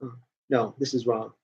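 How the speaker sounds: family voice. Retold or imitated. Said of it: "they'd be like".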